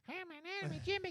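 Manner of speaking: very high, squeaky cartoon imitation; human cartoon voice. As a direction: mimic a cartoon character. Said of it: "he sounds like a human cartoon".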